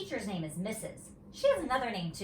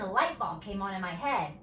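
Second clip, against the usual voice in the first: usual speaking voice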